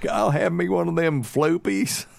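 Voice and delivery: high, put-on country lady voice; drive-thru order. Mother-in-law ordering at McDonald's, high country twang. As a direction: order it like a proud regular. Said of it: "going through the line every day going".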